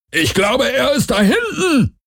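very high creature voice; piercing high-pitched cry; alien hunter's call. Acted. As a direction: screech it to the other hunters.

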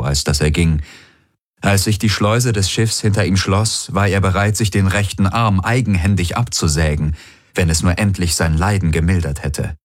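usual speaking voice

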